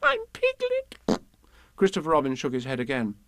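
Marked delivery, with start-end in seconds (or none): 0.0-1.2 very high squeaky insistence; tiny animal voice, drawn out at the end; triumphant Piglet. Acted. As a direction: squeak your vindication. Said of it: "said Piglet".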